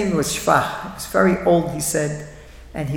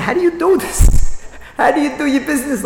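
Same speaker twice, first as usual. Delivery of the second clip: amazed question; 8 semitones higher, 4 dB louder than usual. Retold or imitated. Incredulous question to rich friends.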